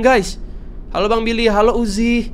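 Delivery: normal talking voice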